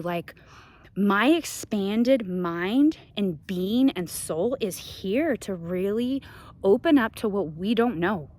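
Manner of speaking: ordinary talk, nothing marked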